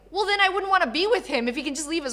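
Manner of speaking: indignant retort. Retold or imitated. Retold retort to her cousin.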